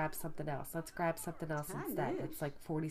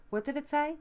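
ordinary delivery

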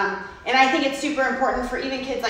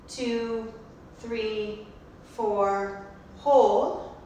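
slow breath counting; much slower than usual. Shown as a demonstration. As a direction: count the breaths slowly and gently. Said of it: "leads breath counting for child".

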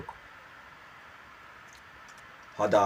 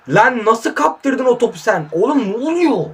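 high, slow, exasperated yell at the player; real frustrated shout, pitched up; angry outburst; 8 semitones higher, 4 dB louder than usual. Real, not acted. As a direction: rage at losing the ball. Raging at a lost ball.

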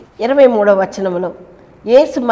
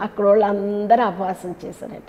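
usual speaking voice

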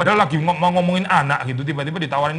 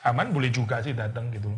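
lower, softer offhand aside; afterthought; 6 semitones lower, 8 dB quieter than usual. Retold or imitated. Esther adding Haman casually as an afterthought. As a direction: toss it off as an afterthought. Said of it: "seperti kayak pikiran belakangan".

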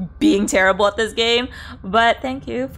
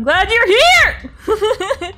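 high, loud, very drawn-out welcome; excited squeal; 11 semitones higher, 5 dB louder, much slower than usual. Real, not acted. Streamer welcoming a viewer to the chat, excited squeal. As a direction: squeal it out, stretching the end into laughter.

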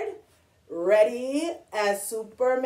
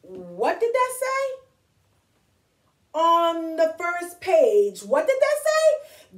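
drawn-out storybook alarm, swooping high; alarmed Grover; 4 semitones higher, 4 dB louder, slower than usual. Acted. Grover learns of the monster at the end, alarmed. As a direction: stretch the words out in exaggerated, fearful disbelief.

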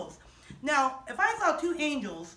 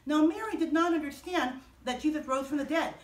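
plain reading voice

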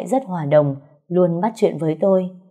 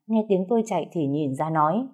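plain reading voice

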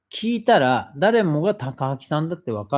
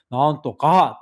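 louder talking-at-you line; mock Takaaki address; 3 semitones higher, 7 dB louder, slower than usual. Imitating Takaaki addressing someone, louder. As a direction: lean in and call it out. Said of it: "こう語りかける時も".

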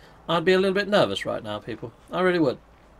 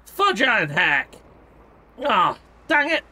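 exasperated groan; 5 semitones higher, 3 dB louder, slower than usual. Real, not acted. Shields broken again.

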